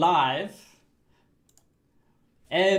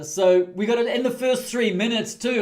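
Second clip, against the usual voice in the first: ordinary delivery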